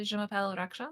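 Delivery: reading out her first French sentence, a bit unsure; French on request. Shown as a demonstration. Asked by a friend, she says her name in French. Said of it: "can you say, "My name is Raksha"?".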